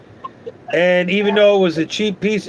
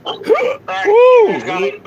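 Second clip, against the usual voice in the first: a sudden high hiccup yelp; 13 semitones higher than usual. Real, not acted. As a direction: let a hiccup jump out. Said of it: "I got the hiccups".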